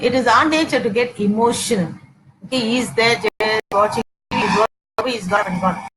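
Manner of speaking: ordinary talk, nothing marked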